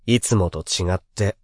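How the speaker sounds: ordinary talk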